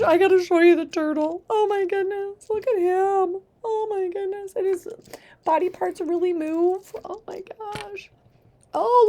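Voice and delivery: put-on high, sing-song baby talk; reading to a toddler. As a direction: coo at them like a toddler. Cooing at toys for kids.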